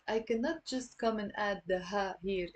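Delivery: ordinary talk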